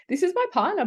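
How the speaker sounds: it dawns on her who it was; high shocked inner thought; shocked realisation. Retold or imitated. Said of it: "I'm just thinking".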